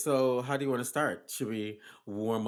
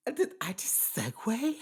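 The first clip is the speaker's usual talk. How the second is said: mock surprise; 10 semitones higher than usual. Surprised at own segue.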